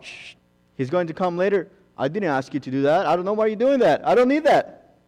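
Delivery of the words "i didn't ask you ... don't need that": God's reply. Acted. Preacher voicing God rejecting work done for him, rising. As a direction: act out God turning the offer down, rising.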